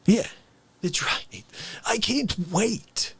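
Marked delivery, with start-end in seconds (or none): none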